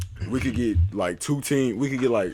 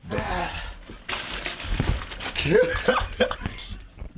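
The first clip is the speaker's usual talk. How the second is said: high breathy laugh; 9 semitones higher than usual. Real, not acted. Friends reacting to chip.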